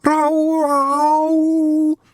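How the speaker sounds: high, loud wailing moan; theatrical poet's wail; mournful moan. A narrator's reading. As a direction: moan like an animal in pain. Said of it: "The moan rolled around the clearing".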